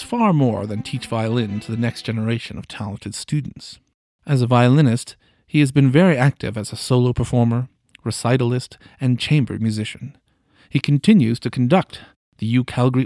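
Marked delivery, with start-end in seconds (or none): none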